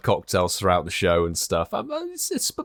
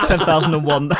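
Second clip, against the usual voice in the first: laughing; 5 semitones higher, 5 dB louder, faster than usual. Real, not acted. Mocking the download count.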